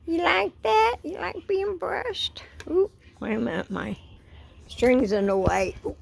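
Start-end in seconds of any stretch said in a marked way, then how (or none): 0.1-2.9 high, doting pet-talk questions. Real, not acted. Owner asking pet about being brushed, high baby talk.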